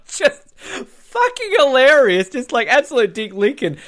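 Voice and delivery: high laughing delight. Real, not acted. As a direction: crack up at the memory. Laughing at the shower scene.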